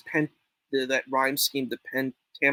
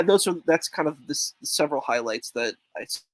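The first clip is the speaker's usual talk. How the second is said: normal talking voice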